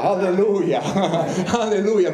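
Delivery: preacher rejoicing to the congregation, laughing alleluia; joyful alleluia. Real, not acted. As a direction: burst out with happy praise. Joyful praise in sermon.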